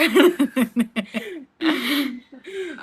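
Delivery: long burst of real laughter. Real, not acted. Woman cracking up at a botched tongue twister, real laughter. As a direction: crack up helplessly.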